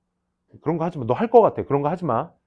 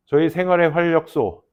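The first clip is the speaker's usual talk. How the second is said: mock ad pitch; 2 semitones higher, 11 dB louder than usual. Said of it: "이, 이런 거 하지 마".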